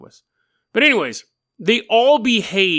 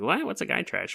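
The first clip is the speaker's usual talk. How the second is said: puzzled hush; 10 dB quieter than usual. Retold or imitated. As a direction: ask it under your breath, confused. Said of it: "And we're like".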